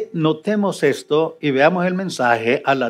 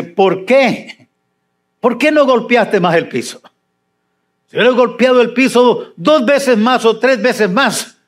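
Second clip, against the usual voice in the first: higher, forceful "¿Por qué no golpeaste más el piso?"; angry rebuke; 7 semitones higher than usual. Acted. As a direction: scold the king hard. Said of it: "está hablando enojado". (el profeta reprende al rey, con enojo fuerte)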